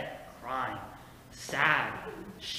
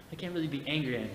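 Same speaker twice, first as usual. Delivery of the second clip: ordinary talk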